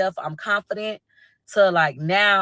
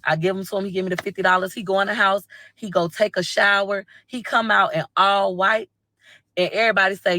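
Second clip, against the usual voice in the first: usual speaking voice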